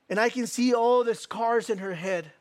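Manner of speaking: ordinary delivery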